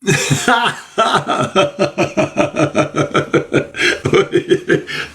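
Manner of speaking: long breathy high laugh that won't stop; helpless laughter. Real, not acted. Laughing helplessly at a viewer's comment. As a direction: lose it laughing at the chat.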